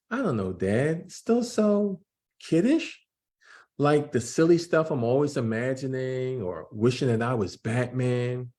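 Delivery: put-on young Jordan voice. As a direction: play the unsure twelve-year-old son. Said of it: "I'm gonna try to do voices to distinguish".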